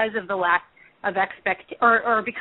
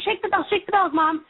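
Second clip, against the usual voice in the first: retold urgent plea, pitched much higher; frantic desperation; 10 semitones higher than usual. Daughter urging her mom to shake the bells, mock-frantic. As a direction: imitate a desperate daughter insisting, voice raised in pitch. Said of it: "sort of the frantic desperation".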